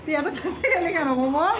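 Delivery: normal talking voice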